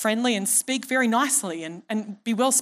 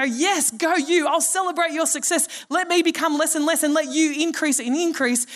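cheering; 6 semitones higher than usual. Retold or imitated. John cheering on Jesus.

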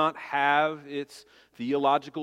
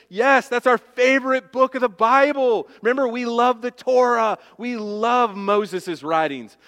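loud, higher, eager crowd answer; eager disciples; 7 semitones higher, 7 dB louder than usual. Acted. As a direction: play the disciples bursting with enthusiasm. Preacher acts out eager disciples.

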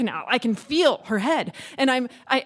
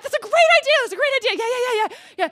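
high-pitched eager babble; frantic agreement; 10 semitones higher, 4 dB louder, faster than usual. Retold or imitated. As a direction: agree in a rush of panic. Frantically agrees to husband's plan.

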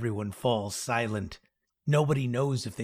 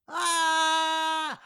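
long high-pitched scream; drawn-out startled "Ah!"; yell. A narrator's reading. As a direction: scream on one held high note. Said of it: "I yell".

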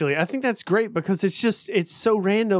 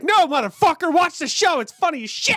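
exasperated plea; 10 semitones higher than usual. Retold or imitated. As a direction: beg them to just watch it, half yelling. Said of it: "And it's like".

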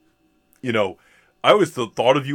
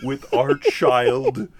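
bewildered parents; much slower than usual. Retold or imitated. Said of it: "were like".

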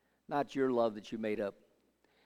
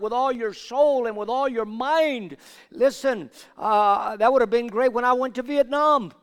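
loud, high-pitched sermon delivery; full-force preaching; 9 semitones higher, 12 dB louder than usual. Real, not acted. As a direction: drive it at the congregation at full volume.

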